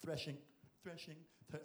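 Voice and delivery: hushed, timid mutter; mock hushed timid labour; mock quiet threshing. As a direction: play the scared man working silently. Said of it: "just trying to be quiet".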